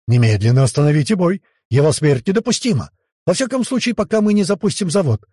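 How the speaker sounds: shouting. A narrator's reading. Emperor orders the fight stopped, crying out urgently. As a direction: cry out an alarmed order. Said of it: "прокричал император".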